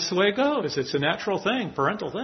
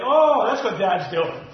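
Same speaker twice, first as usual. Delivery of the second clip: drawn-out, higher acted "oh" of realization; dawning realization; 6 semitones higher, slower than usual. Boy in a story realizes why Dad disciplines him, mock dawning realization. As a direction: act out the penny dropping, slowly.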